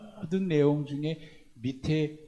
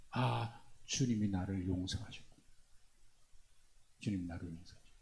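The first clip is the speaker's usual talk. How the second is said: much softer and lower, fading away; hushed awe; 7 semitones lower, 10 dB quieter than usual. Acted. Believer realizing forgiveness, hushed and tender. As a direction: breathe it out in quiet wonder.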